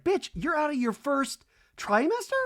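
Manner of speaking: high-pitched incredulous question; hammed-up high astonishment; imitated disbelief. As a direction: squeak it in mock shock. Imitating friend's shocked question.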